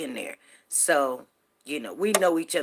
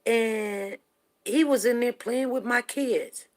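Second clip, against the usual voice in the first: normal talking voice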